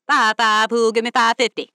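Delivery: loud auctioneer rattle; auctioneer call. Acted. Auctioneer on loudspeaker.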